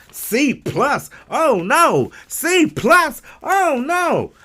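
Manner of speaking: high, childlike dismay, a touch hammed-up; a kid crushed by a bad grade; Ralphie. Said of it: "I felt like Ralphie from A Christmas Story".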